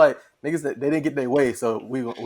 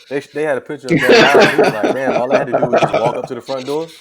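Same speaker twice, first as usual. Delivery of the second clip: loud, laughing retelling; words bursting through laughter; laughing while talking; 2 semitones higher, 9 dB louder than usual. Real, not acted. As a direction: crack up while telling it. Laughs telling a joke about intruder.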